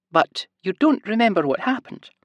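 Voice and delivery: high sing-song question; gentle high nurse; Scottish nurse voice. A narrator's reading. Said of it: "a heavy Scottish accent and a high-pitched sing-song voice".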